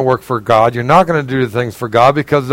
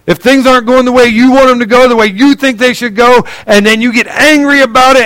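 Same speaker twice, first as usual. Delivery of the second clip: rising preacher fervor; 8 semitones higher, 5 dB louder than usual. Real, not acted. Preacher building up intensity.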